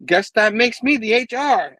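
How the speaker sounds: high, loud cocky delivery; acted loud wisecrack; heroic quip. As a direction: call out the punchline boldly. Acting Spider-Man's quip.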